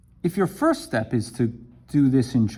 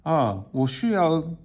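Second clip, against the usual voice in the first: easy, satisfied Chinese; content Chinese speaker; 5 semitones higher than usual. Shown as a demonstration. Teacher shows the easy Chinese sentence before the struggle. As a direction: say it smoothly like a pleased native. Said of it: "look at the difference".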